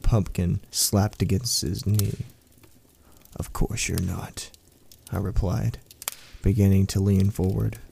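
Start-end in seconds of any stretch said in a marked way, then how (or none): 3.4-4.5 soft, slow and menacing; quiet menace. A narrator's reading. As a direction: lull him, quietly sinister. Creature soothes menacingly.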